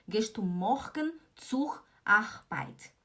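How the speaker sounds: slow, word-by-word MORGEN, ZUR, ARBEIT with hard throaty Rs; wrong-example "morgen zur Arbeit" with heavy Rs; mixed Swiss-German R. Shown as a demonstration. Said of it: "Não fala assim".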